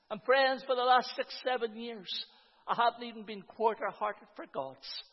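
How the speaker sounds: ordinary talk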